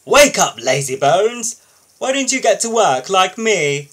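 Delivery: loud, high, bossy taunting; a snooty engine mocking sleepy engines; rude. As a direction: wake them rudely, full of himself. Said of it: "he went on rudely".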